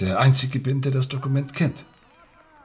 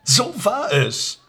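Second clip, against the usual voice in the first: much higher and louder, pleading to be believed; insistent outburst; 8 semitones higher, 5 dB louder, much slower than usual. Acted. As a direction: push it out high and urgent, protesting. Suspect insists on his story.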